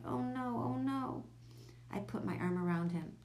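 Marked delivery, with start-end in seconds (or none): 0.0-1.2 higher, lightly tearful repetition; understated sobbing dismay; sobbed. A narrator's reading. Brian fears his mouse is dead, mildly sobbing. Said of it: "he sobbed".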